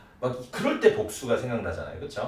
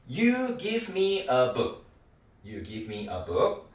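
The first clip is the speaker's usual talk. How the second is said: very slow, words pulled apart; slow drill; much slower than usual. Shown as a demonstration. Teacher modeling you give me. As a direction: model it slowly for learners. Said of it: "빠르게 한번 연습해보겠습니다".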